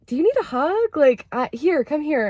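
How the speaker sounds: high, gentle coaxing voice; tender offer. Retold or imitated. Offering a hug to the sulking partner, high and tender.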